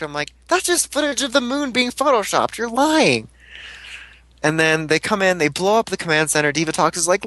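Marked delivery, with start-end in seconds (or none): 0.5-3.3 high-pitched, scoffing denial; hammed-up incredulous protest; mock disbelief. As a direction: dismiss it as fake in a raised pitch. Acted denial of the news.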